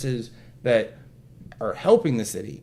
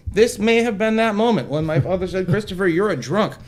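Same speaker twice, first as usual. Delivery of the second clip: higher, louder, mock-momentous delivery; mock-grand retelling; 7 semitones higher, 4 dB louder than usual. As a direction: announce it like a life-changing moment, tongue in cheek. Mock-inspirational quote of father.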